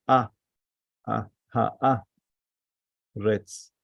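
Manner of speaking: isolated syllables "A. A. Ha. A. Retz." with pauses; kametz demonstration. Shown as a demonstration. Teacher showing the kametz vowel, isolated syllables. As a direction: sound out "A. A. Ha. A. Retz." one at a time. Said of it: "Today we learned the kametz".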